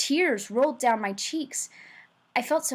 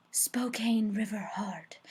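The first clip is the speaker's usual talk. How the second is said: whispered like a precious secret; dreamy whisper; 5 dB quieter, slower than usual. A narrator's reading. Narrator dreaming of his sister's novel, whispered. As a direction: breathe the title slowly, in a whisper.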